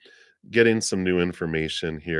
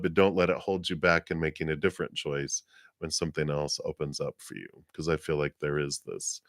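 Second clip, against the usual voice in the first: ordinary talk, nothing marked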